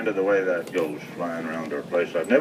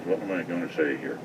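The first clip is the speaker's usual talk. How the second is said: ordinary delivery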